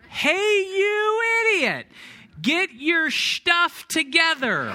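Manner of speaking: yelling back down the fairway after the ninth-hole ball; angry yell. Retold or imitated. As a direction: let it explode at the golfer who kept hitting into you. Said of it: "And I yelled".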